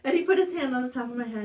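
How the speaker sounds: ordinary talk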